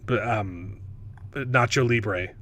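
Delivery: ordinary talk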